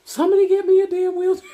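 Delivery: high, loud, fast mock yell; urgent yell. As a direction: holler for help in a hammed-up panic. Acting out calling for help.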